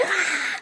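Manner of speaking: high-pitched mock scream, held back in volume. Shown as a demonstration. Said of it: "like, screaming".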